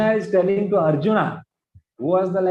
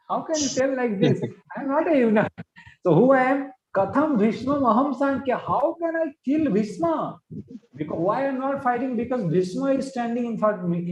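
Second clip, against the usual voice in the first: higher-pitched, protesting acted Arjuna; indignant self-justification; 5 semitones higher than usual. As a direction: plead your case in a raised pitch. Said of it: "he is now going to justify himself".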